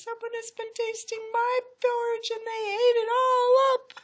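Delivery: put-on very high, loud baby bear wail; crying wee little voice. A narrator's reading. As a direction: build to a cub's squealing wail. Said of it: "cried Baby Bear in his wee little voice".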